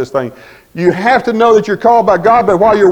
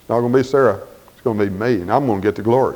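much lower, softer, solemn God voice; 10 semitones lower, 8 dB quieter than usual. Retold or imitated. God telling Abraham the glory is his, low and solemn. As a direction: speak as God, low and assured. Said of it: "And then God says".

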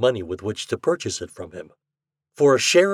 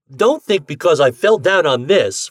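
heated, louder and higher; hot; 6 semitones higher, 8 dB louder than usual. A narrator's reading. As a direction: defend himself hotly. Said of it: "Ford began hotly".

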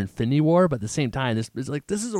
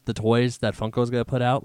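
normal talking voice

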